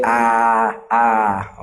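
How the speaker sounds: long, open "Ah"; doctor's "ah". Shown as a demonstration. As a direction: hold "Ah" as for a throat check. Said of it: "when you go to the doctor".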